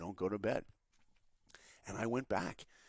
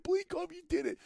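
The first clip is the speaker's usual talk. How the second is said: high, excited self-congratulation; elated self-cheer; 4 dB louder than usual. Retold or imitated. Intern cheering himself after the call, high and elated.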